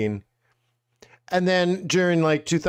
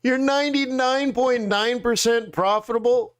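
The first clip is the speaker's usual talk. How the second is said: disbelieving gasp at a crazy number; mock shock at ninety-nine percent profit, pitched high; stunned disbelief; 7 semitones higher than usual. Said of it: "You're like".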